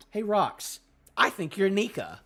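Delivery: normal talking voice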